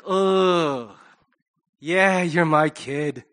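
groaning, slow admission; reluctant parent. Acted. Parent seeing a child act that way, reluctant. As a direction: own up to the kid with a drawn-out groan.

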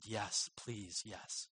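very soft, low, understated pleading; retold tearful plea. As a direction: imitate a tearful yes, hushed and restrained. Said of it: "she just burst out in tears".

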